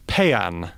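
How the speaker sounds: paean said as PEE-an; pronunciation. Shown as a demonstration. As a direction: say paean carefully, stressed on the first syllable. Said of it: "there's a word in English".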